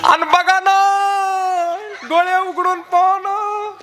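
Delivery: high, slow wailing plea over the dead; keen it like a grieving daughter; mock lament.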